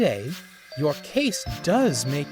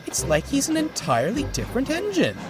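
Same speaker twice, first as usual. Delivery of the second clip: high, fast baffled exclamation; can't believe the change in him; bewildered; 3 dB louder, faster than usual. A narrator's reading. Winnie puzzled by Horace, bewildered.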